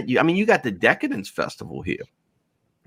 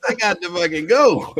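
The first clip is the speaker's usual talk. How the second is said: higher, laughing as he backs out; laughing retold panic; 7 semitones higher than usual. Leaving the hairy bar, laughing in mock panic. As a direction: squeal it through laughter, backing away. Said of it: "I was like".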